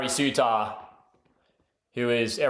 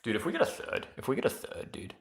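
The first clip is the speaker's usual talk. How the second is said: breathy whisper, hushed and hopeful; 9 dB quieter than usual. Real, not acted. Fan hoping aloud for a third goal, whispering. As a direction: whisper it like you daren't jinx it.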